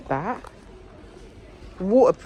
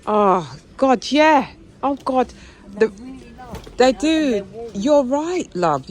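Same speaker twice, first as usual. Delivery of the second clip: excited; 8 semitones higher, 6 dB louder, slower than usual. Real, not acted. Excited reaction to sale item.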